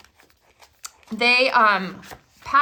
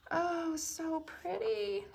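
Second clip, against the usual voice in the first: cooing in awe; 7 semitones higher, 10 dB quieter, much slower than usual. Real, not acted. Admiring new card papers.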